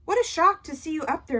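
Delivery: high, loud astonished praise; bursting with pride; amazed. A narrator's reading. Dad amazed backstage.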